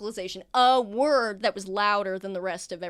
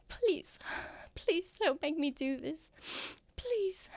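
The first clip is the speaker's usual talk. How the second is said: much higher, soft, begging please; desperate pleading; 8 semitones higher, 6 dB quieter, slower than usual. Acted. A frightened patient begs the doctor, high and soft. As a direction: beg him not to make you do it.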